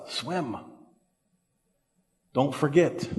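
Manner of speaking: soft, understated urging; hushed advice. Retold or imitated. Someone coming along in hard times, hushed advice. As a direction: say it gently, well under normal volume.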